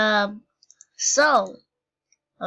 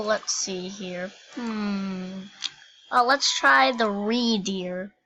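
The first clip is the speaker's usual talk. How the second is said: usual speaking voice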